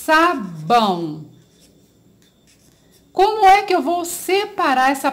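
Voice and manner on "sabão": "sabão" drawn out slowly for the class; teacher presenting a word to children; slow, clear word. Shown as a demonstration. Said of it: "eu tenho aqui a palavra".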